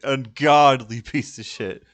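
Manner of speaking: slow, loud, laughing mock-dramatic ungodly; slow loud parody of a YouTube critic; mock reviewer delivery. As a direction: ham up the reviewer's big line, laughing through it. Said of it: "I love the way he fucking stepped to the camera there".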